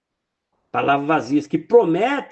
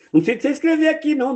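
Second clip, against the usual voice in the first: ordinary talk, nothing marked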